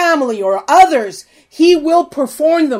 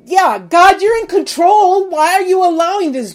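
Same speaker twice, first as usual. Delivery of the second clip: mock accusing complaint; 6 semitones higher, 5 dB louder than usual.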